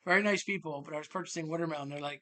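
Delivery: ordinary delivery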